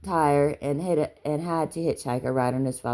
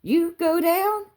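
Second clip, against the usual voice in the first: put-on very high, slow child's question; drawn-out, piping little-boy tease; beaming child calling; 4 dB louder, slower than usual. A narrator's reading. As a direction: call it gleefully from a car window. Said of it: "he stuck his beaming face out the window".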